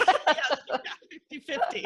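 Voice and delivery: laughing through the words. Real, not acted. Guest laughing at the half crazy joke. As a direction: giggle it out.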